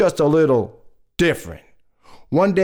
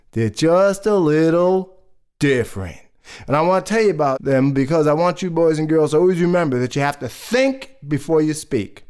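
ordinary delivery, nothing marked

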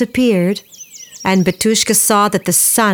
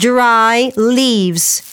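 ordinary delivery, nothing marked

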